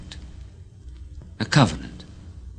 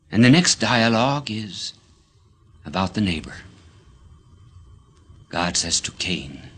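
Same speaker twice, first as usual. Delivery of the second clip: normal talking voice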